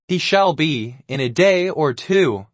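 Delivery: ordinary delivery, nothing marked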